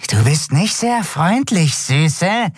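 a skeleton sneering at the woman; hissing skeleton. A narrator's reading. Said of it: "zischte es".

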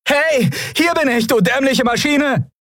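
high-pitched taunting shout; calling out. A narrator's reading. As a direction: yell to draw the machine's attention. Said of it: "winkend und rufend".

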